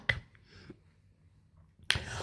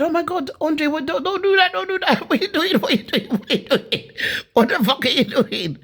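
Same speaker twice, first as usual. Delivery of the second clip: much higher, frantic pleading with nervous laughs; retold panicked pleading; 14 semitones higher than usual. Friend retelling how he begged Andre to stop, higher frantic pleading. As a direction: beg him to stop, half laughing in shock.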